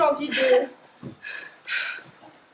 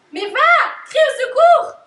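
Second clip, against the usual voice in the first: loud, high panicked order; urgent shout; 8 semitones higher, 9 dB louder than usual. Acted. Panicked girl orders a friend to cry for help, shouting. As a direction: yell at her to go get help. Friend collapses, urging someone to call.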